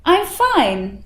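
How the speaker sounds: happy and confident "I'm fine"; buoyant tone. Shown as a demonstration. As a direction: sound like all is truly good. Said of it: "the buoyant tone".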